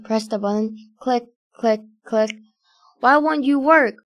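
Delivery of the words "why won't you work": higher-pitched, mildly angry shout. A narrator's reading. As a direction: raise it in frustration. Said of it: "shouted angrily".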